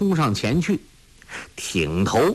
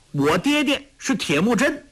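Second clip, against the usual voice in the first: put-on boy's proud declaration, much higher; 11 semitones higher than usual. A narrator's reading. Young Tolui answers bully, proudly high. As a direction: chin up, proud child voice. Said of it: "托雷傲然说".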